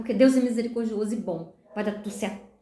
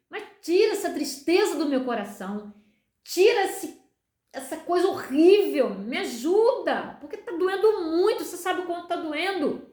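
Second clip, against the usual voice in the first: pained plea; 5 semitones higher than usual. Retold or imitated. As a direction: plead for relief, stretching the key words. Acted plea to God in pain. (súplica sofrida, arrastada e mais aguda)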